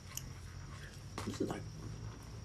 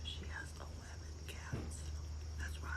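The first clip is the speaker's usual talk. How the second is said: breathy conspiratorial whisper aside; 19 dB quieter than usual. Real, not acted. Whispering to chat about his eleven cats. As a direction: lean in and whisper the secret.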